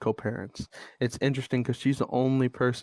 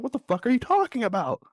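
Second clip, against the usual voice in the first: high-pitched exasperated outburst, re-enacted; incredulous retort from a retold argument; frustrated; 12 semitones higher than usual. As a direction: voice shoots up in disbelief. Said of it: "I'm getting frustrated".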